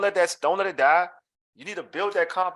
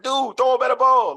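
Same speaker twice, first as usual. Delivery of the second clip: loud, raised-pitch yell at a teammate; mock-angry shout; acted yelling; 8 semitones higher, 7 dB louder than usual. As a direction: holler it downfield in frustration. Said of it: "I'm yelling at the quarterback".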